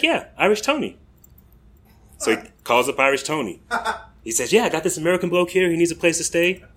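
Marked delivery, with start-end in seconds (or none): 0.0-1.0 put-on high, chirpy British accent; a cheerful English local in an American's British accent; bright suggestion in an imitated British accent; British accent attempt. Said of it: "my attempts to do the accent". 4.5-6.6 put-on fast, higher British accent on the phone; quick imitated British accent on a phone call; British accent attempt. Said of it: "my attempts to do the accent".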